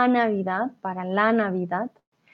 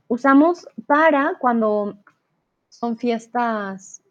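ordinary delivery

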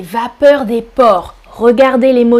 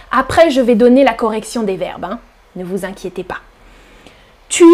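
ordinary talk

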